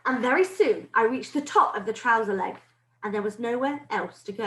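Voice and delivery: plain reading voice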